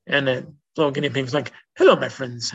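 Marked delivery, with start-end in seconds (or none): 1.8-2.5 put-on British accent. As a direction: mimic a cute British-accented guinea pig. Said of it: "in a British accent".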